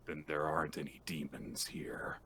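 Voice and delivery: a soft breathy whisper; a whispered, gentle verdict. Acted. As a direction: let the line fall to a whisper. Tender punchline of Kiyoshi's speech.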